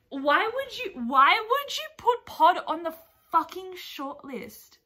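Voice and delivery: high, loud exasperated outburst; indignant wail of real frustration; angry. Real, not acted. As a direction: demand an answer, loud and drawn out. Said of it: "why am I angry".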